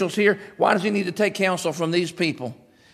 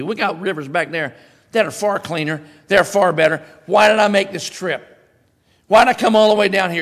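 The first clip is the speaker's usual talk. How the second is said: louder, huffy complaint; Naaman fuming; 4 semitones higher, 6 dB louder than usual. Retold or imitated. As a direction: build up the offended grumbling. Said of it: "went away in a rage".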